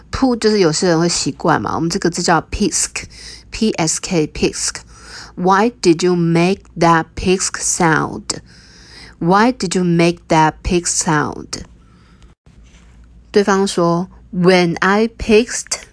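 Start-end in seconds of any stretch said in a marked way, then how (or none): none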